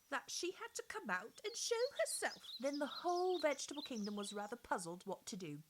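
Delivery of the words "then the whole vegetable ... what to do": plain reading voice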